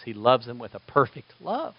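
ordinary talk

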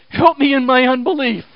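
desperate man's plea, preached; pleading cry. Acted. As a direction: cry out for help, high and loud. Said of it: "he goes".

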